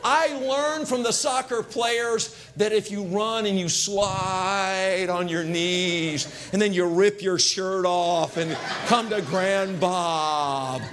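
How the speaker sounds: slow-motion reunion; slow-motion narration. Acted. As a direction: play it like a film climax.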